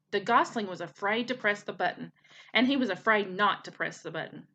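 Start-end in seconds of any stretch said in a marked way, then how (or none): none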